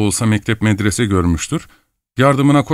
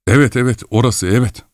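ordinary delivery

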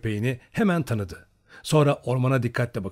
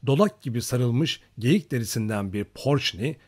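usual speaking voice

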